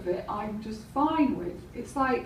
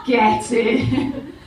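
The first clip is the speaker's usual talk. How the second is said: imitated catchphrase; 7 dB louder than usual. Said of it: "puts a jacket potato in the oven".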